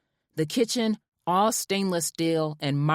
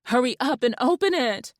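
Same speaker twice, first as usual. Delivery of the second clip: higher, urgent; impatient excited; 9 semitones higher, 3 dB louder than usual. A narrator's reading. Wife urging husband to open the new house. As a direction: hurry him along. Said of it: "I shouted".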